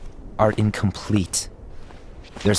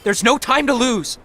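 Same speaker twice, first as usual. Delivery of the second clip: high-pitched, pressing cry; urgent; 14 semitones higher than usual. Acted. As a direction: urge them on, voice pitched high. Urging Ava to hurry.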